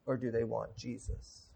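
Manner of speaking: very soft, low and weighty; hushed question. Real, not acted. Preacher asking what the crowds truly want, hushed question. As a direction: drop right down for the pointed question.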